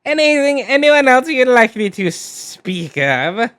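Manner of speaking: high, theatrical cartoon villain; evil villain voice. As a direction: sneer it like a scheming villain. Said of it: "my evil villain voice".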